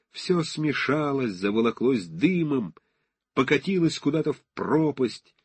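usual speaking voice

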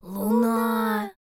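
drawn-out amazed cry; awed exhalation. A narrator's reading. Hedgehog and bear cub seeing the moon, awed exhalation. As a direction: breathe it out together in wonder. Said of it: "в один голос выдохнули".

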